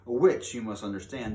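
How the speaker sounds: ordinary delivery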